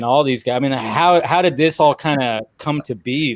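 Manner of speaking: ordinary talk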